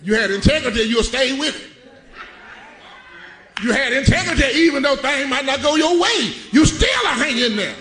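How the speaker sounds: loud, high-pitched preaching shout; preaching at full force. Acted. Preacher shouting to his congregation about integrity, full-force preaching. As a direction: drive it home to the church.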